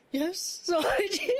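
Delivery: bashful giggly yes; sheepish laugh. Retold or imitated. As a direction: answer shyly, laughing through it. Sheepish answer on phone.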